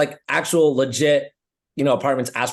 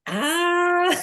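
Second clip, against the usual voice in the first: mock high ah. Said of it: "And Cam's like".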